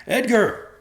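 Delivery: high, loud shout after him. A narrator's reading. Joe shouting after his fleeing son, high and loud. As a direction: call out into the storm. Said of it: "Joe shouted after him".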